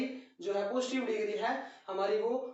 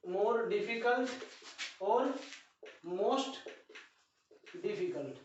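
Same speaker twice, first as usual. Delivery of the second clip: more difficult drawn out very slowly; slow model phrase; much slower than usual. Shown as a demonstration. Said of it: "teacher models the phrase".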